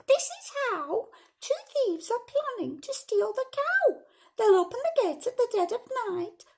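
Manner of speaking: very high, loud little voice; high-pitched storybook ladybird. A narrator's reading. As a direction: squeak the news out to all the animals. Ladybird urgently tells the animals.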